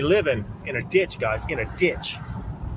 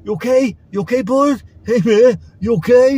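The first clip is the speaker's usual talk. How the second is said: high, loud taunting "you okay" voice; mocking kid; 9 semitones higher, 8 dB louder than usual. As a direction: ham up the jeering kid. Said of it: "one kid came up".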